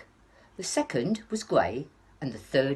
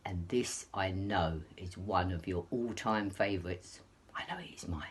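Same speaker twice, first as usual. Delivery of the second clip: whisper; 9 semitones lower, 8 dB quieter than usual. Real, not acted. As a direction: share it like a secret. Confiding aside to children.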